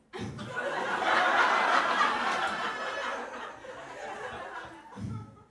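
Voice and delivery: big airy burst of laughter; loud breathy laugh. Real, not acted. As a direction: break up laughing loudly. Laughs mid-impression.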